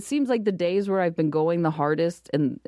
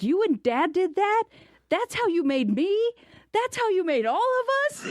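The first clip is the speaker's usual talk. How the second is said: put-on higher, rising, shocked kid voice; stunned disbelief; 14 semitones higher than usual. Son asking his mom in shock about his parents, stunned disbelief. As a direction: play the boy who can't believe it.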